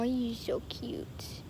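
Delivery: slow whisper to a kitten; whispered coo to a pet. Real, not acted. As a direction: breathe it out slowly to the cat. Cooing at the kitten.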